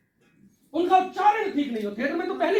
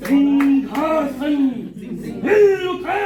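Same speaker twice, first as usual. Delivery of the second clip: loud, slow roar rising in pitch; furious theatrical shout; angry; 6 dB louder, much slower than usual. Shown as a demonstration. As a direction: declaim it in a stretched, mounting rage. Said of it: "गुस्से में बदल दो".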